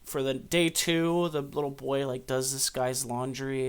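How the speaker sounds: ordinary talk, nothing marked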